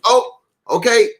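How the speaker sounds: plain reading voice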